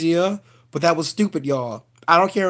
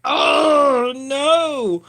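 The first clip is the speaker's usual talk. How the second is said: mock dismay; 8 semitones higher, 4 dB louder than usual. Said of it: "I'm like".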